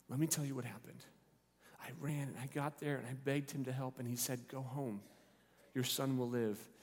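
softly told, intimate account; hushed testimony. Acted. Father telling his household what happened, soft and hushed. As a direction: tell it quietly to those gathered close.

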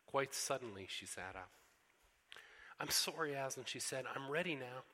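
storybook reading with voiced characters; story reading. A narrator's reading. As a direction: read it aloud like a bedtime story for the congregation. Pastor reads Narnia story aloud.

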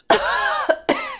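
harsh cough from chili; coughing on chili powder; spicy cough. Real, not acted. Choking on chili coating.

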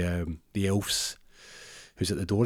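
ordinary talk

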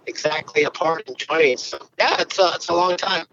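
muffled underwater phone line. Real, not acted. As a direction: sounds like talking underwater. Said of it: "sounds like you're, like, underwater".

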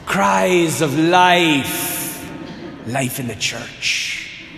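slow, low Irish priest, gently amused; Irish accent. Retold or imitated. Priest thanking parents of a crying baby, slow low Irish accent. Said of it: "he still had the Irish accent".

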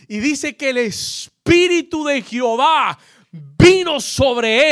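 loud, rising preacher's call to the crowd; thundering pulpit delivery climbing to a roar; full-force preaching. Acted. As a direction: preach it out, building to a shout.

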